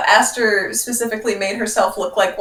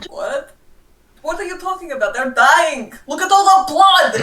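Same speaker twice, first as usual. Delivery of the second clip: panicky disguised cultist; 7 semitones higher than usual. Acted. Character panics about the dying friend.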